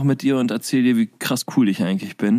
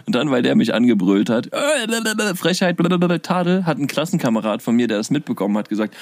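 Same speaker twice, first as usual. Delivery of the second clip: normal talking voice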